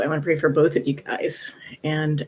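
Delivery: whispered, almost unvoiced; hushed whisper. Real, not acted. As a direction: whisper it softly, like a prayer. Offering to pray for them.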